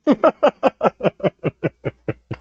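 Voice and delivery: long staccato laugh, falling in pitch; mock laugh. Comic acting out the news being broken, long hammed-up laugh. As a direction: let out a drawn-out machine-gun cackle.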